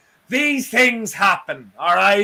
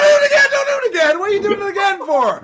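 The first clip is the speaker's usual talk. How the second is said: much higher, squealing "don't do it again"; laughing plea; 9 semitones higher, much faster than usual. Real, not acted. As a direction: beg them to stop, half laughing. Pleading as the image returns.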